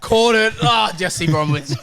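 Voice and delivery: high, loud startled cry; mock panic. Teammate catching a bad pass, yelping in mock panic. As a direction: yelp it as the tackler hits. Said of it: "He's like".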